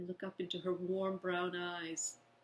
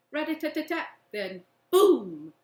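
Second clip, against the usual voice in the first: higher rattle then loud boom; mock gunfire and boom; 6 semitones higher, 11 dB louder than usual. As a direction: play the dogfight noises. Narrator imitating gunfire, explosion.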